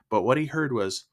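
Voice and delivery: ordinary delivery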